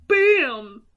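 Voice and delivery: loud, sudden bam; explosive shouted bang; imitated gunshot. Joker shoots Murray mid-joke, loud bang.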